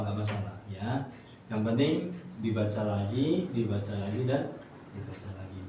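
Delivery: calm, low-voiced encouragement; gentle reassurance. Real, not acted. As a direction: reassure a struggling pupil, low and soft. Teacher reassures a struggling student.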